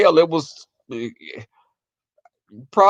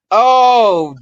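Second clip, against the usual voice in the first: loud, higher, drawn-out oh; sudden realization; 4 semitones higher, 7 dB louder than usual. Retold or imitated. Realizing what grandma meant by Peter, dawning surprise. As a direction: let the penny drop loudly.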